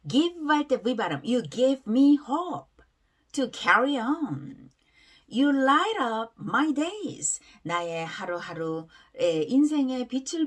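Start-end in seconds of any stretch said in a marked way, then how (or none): 1.2-7.4 very slow, drawn-out reading; pronunciation demo. Shown as a demonstration. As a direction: stretch each word for learners to repeat. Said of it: "발음 한번 따라해볼게요". (따라 하도록 아주 천천히 늘여 읽는 발음 시범)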